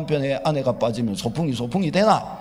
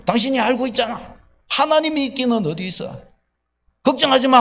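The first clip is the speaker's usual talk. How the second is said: much higher and louder, ranting husband; angry boastful rant; 10 semitones higher, 6 dB louder than usual. Retold or imitated. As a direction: shout it out, proud and indignant. Husband ranting, boasting his own effort. (자기 노력을 자랑하며 아내에게 화내는 남편, 높고 큰 목소리로 소리치듯)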